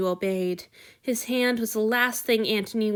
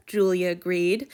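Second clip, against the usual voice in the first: usual speaking voice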